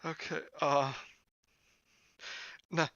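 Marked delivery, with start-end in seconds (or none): none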